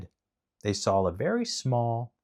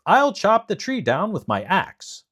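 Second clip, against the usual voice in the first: character voice; 10 semitones higher, 6 dB louder than usual. A narrator's reading. Tin Woodman voiced.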